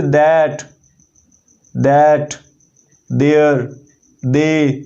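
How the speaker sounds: that, that, there, they each begun with a soft, voiced dental d-like TH; soft TH sound. Shown as a demonstration. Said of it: "ये थोड़ी soft sound आ रही है".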